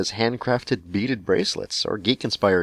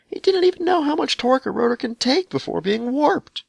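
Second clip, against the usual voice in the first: mock outrage, pitched far higher and louder; outraged rant; 4 dB louder than usual. Letter writer fumes at her mechanic, mock outrage. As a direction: play the wronged crank blowing up.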